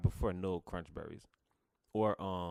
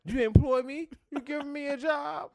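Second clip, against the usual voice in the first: a lot higher and louder, mock excited; 8 dB louder than usual. Mishearing applaud as employ, in a mock high voice. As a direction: play it as a joking squeal of delight.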